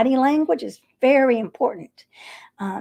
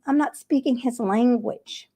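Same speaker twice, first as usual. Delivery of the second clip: normal talking voice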